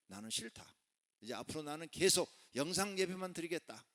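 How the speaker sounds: high-pitched mock declaration; defiant refusal. Preacher mimicking a member who rejects church, defiant.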